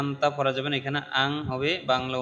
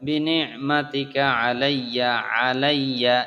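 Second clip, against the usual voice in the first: usual speaking voice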